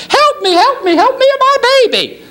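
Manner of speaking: hammed-up panicked lady screaming from a window; a trapped mother calling down from the burning building, frantic falsetto; frantic woman.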